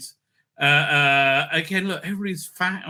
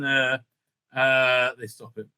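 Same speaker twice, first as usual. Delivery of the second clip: usual speaking voice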